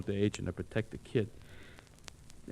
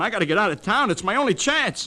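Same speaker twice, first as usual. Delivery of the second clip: rising higher and louder in panic; desperate pleading; 10 semitones higher, 6 dB louder than usual. Acted. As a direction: beg in growing panic. Bob desperate to flee town.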